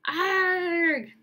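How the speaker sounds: high loud strangled cry; terrible scream. Acted. Malfoy screaming in terror at the hooded figure, loud cry. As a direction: let a sharp scream rip out.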